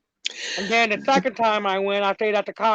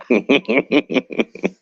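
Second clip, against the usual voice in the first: short laugh; 2 semitones lower, 5 dB louder than usual. Real, not acted. Laughs before ghost story.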